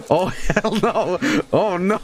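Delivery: slow, laughing oh no; laughing panic. Real, not acted.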